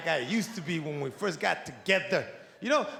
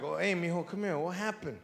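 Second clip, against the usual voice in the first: soft coaxing dad; 4 semitones lower, 7 dB quieter than usual. Retold or imitated. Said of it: "I gotta go up to him like".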